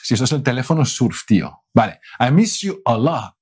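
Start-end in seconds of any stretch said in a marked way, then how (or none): none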